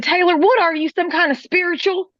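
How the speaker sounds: high, loud, astonished question; imitating a stunned coworker, laughing; amazed coworker. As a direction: marvel at her, half laughing.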